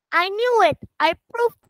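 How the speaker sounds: high, excited triumph. Acted. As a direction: cheer it happily. Mouse triumphs at the end.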